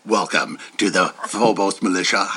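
very low brooding intro; deep Batman voice. Shown as a demonstration. Rehearsing the podcast intro as Batman. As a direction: growl it way down low. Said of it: "deep Batman voice".

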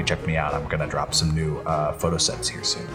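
usual speaking voice

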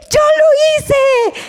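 put-on very high, slow little-kid admission; proud child. Little boy admitting he drew it, high and proud. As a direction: say it like a proud toddler.

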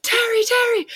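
high mock-panicked calls; Steve Irwin. The host calling his wife, mock panic. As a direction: call out in hammed-up alarm. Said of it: "He's like".

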